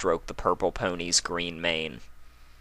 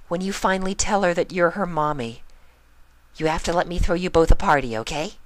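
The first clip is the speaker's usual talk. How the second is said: higher, quicker coaxing request; hopeful Pinkie; 11 semitones higher, 4 dB louder than usual. A narrator's reading. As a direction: wheedle her into a party. Pinkie asks for party.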